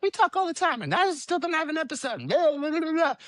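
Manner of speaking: very high-pitched mimicry of a nagging friend; mock nagging. Host mimicking the friend who gives him crap, mock nagging. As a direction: whine it in a squeaky, exaggerated complaining voice. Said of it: "giving me a lot of crap 'cause she's like".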